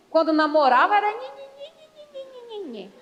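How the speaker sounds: put-on high, whiny baby talk nagging sound; sweet childish nhe nhe babble; mock whiny nhe-nhe. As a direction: coo it like a sugary girlfriend. Mocking sweet courtship talk.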